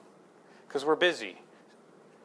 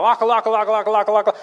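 louder, higher nonsense syllables, half laughing; mock foreign gibberish; 7 semitones higher, 7 dB louder, faster than usual. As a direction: parody a foreign interviewee. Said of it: "And they go".

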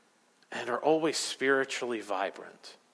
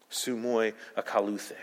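ordinary delivery

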